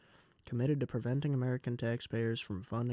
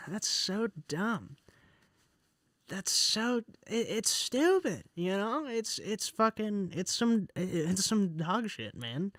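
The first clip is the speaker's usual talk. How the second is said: much higher and slow, fuming disbelief; real frustrated rant; 8 semitones higher, 3 dB louder, slower than usual. Real, not acted. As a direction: drag out each word, furious at the veto. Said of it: "I'm so mad".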